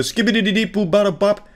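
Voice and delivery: fast, high gibberish scat; mock scat. Narrator mocking the angry woman's gibberish as a fast, high scat. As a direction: rattle off nonsense like a furious scat singer. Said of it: "she just did a full scat".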